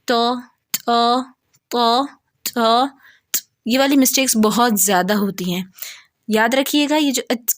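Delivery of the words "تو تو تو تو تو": تو with an aspirated h after the t; ta-point mistake. Shown as a demonstration. Teacher showing the breathy ta error. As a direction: add an h puff to تو. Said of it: "تا کے مخرج سے پڑھتے ہیں".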